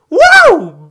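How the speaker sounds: very high, loud cheering whoop. Real, not acted. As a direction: let out a wild whoop of joy. Cheering at missed game mode.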